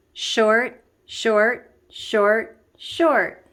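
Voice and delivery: chanting short beats; demo rhythm. Shown as a demonstration. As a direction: snap each short off crisply. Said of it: "like this".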